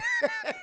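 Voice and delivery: real squealing giggle; high laugh. Real, not acted. Reviewer laughing at his Peter impression, high giggle. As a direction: crack up after the punchline.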